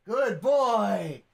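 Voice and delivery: high, stretched-out praise for a pet; praising the dog. Real, not acted. Owner praises the dog for speaking, drawn-out praise. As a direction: gush over a good dog.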